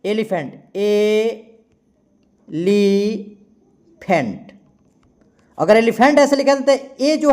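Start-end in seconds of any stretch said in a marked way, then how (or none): 0.1-4.5 drawn-out elephant; slow spelled-out word. Shown as a demonstration. Teacher slowly dictating to students. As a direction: stretch elephant out slowly for the class. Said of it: "तो elephant हम ऐसे लिखेंगे".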